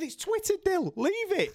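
squeaky, very high pleading; high-pitched exasperation. Retold or imitated. Presenter begging a boxer to drop it, high-pitched exasperation. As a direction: voice shoots right up in mock despair. Retold desperate plea to boxer.

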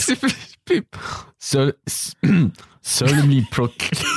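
halting, badly faked French accent. Shown as a demonstration. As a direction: slowly choke out a terrible French accent. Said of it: "I sound like one of the Unsullied".

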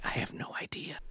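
barely audible whisper; whispered aside. Retold or imitated. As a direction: whisper it as a helpless admission. Acted reply to wife's question.

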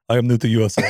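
deep mock-Russian delivery; Russian accent. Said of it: "It would sound better if it was like".

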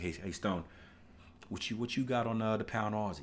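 softly voiced message to a friend; low-key call to his trading partner; quiet casual hey to Stone; quiet retold chat.

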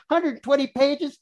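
high, loud, drawn-out disbelief; mock a shocked sceptic, slow and high; hammed-up astonished question; incredulous mimicry.

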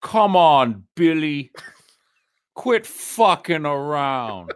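imitated coach, words stretched out; old crew coach. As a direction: scold slowly like an old coach. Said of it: "He goes".

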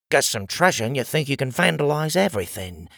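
usual speaking voice

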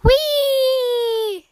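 high, long drawn-out whee; high-pitched childlike glee; gleeful squeal. Acted. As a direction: squeal like a little penguin at play. Young penguin at play calls out.

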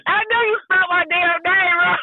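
high shrieking laugh around high-pitched words; real, helpless high laughter; laughing accusation. Real, not acted. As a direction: accuse while cracking up. Cracks up at misspelled name.

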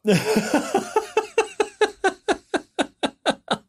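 long high-pitched giggle fit; helpless squeaky laughter; high squealing laugh. Real, not acted. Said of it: "I'm, I'm sorry".

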